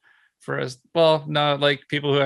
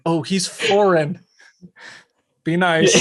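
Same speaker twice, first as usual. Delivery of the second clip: higher, slower, then a laughing shout; a hammed-up accommodating local; mock polite locals; 4 semitones higher, 3 dB louder than usual. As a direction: imitate locals being extra kind to a foreigner. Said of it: "be like".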